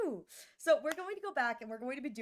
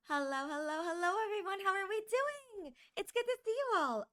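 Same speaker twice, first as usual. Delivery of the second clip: cute greeting; 8 semitones higher than usual. Acted. Streamer greets chat in cute voice.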